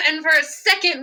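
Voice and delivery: ordinary delivery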